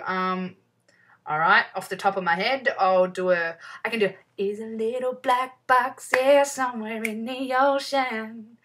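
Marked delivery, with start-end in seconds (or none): 4.4-8.6 slow, lightly put-on Kiwi voice. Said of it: "That was me doing [name]".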